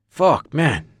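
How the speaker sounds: ordinary talk, nothing marked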